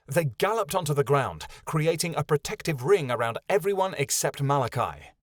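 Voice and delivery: ordinary delivery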